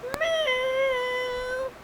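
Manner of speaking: high, drawn-out meow; cat meow. Retold or imitated. Friend's cat meowing, high and drawn out. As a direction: imitate the cat's meow. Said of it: "a cat that would go".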